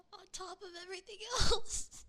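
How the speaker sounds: high, loud, fast laugh-crying. Real, not acted. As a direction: burst out laughing, near tears. Laughing retelling disaster.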